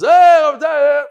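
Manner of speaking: loud, high, slow call across the room. Retold or imitated. Rabbi calls across the room for a translator, slow loud shout. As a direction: shout it slowly across a hotel lobby.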